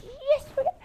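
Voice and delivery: high, bright, eager burst; bouncy joy of a happy mom; cheerful morning. Retold or imitated. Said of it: "I used to get up in the morning like".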